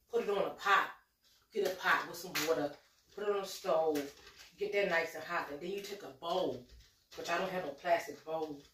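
usual speaking voice

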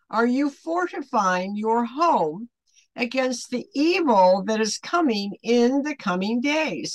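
ordinary talk, nothing marked

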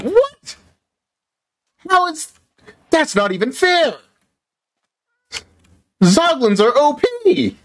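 very high frantic yelling; overwhelmed chaotic squealing; frantic excited. Real, not acted. Frantic outrage and chaos.

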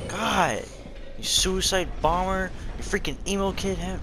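raging at an unfair attack; getting mad. Real, not acted. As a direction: vent real frustration at the boss. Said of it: "I got mad".

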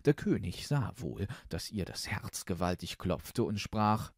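usual speaking voice

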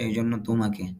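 ordinary talk

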